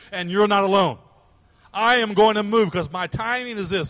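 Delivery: emphatic, driving preacher's delivery; impassioned preaching. Acted. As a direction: proclaim it with mounting force. Preacher builds surrender and God's answer.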